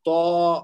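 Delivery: "تو" as a long, backed "taw"; lengthened vowel demo. Shown as a demonstration. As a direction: hold the vowel of تو for two beats. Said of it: "دو حرکت تک کھینچنا ہے".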